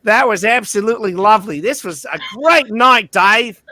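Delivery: high, hammed-up New Zealand accent; Pip's thickened accent. Pip saying goodnight to Dave, thick New Zealand accent. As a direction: lay on a thick, lively Kiwi accent. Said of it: "her accent getting a little thicker".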